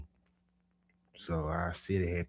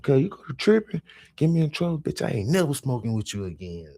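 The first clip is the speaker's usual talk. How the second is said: high, loud angry threat; threatening yell; 8 semitones higher, 6 dB louder than usual. Retold or imitated. Narrator threatens his high cousin, yelling. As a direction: scold him hard. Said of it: "I'm threatening him like that".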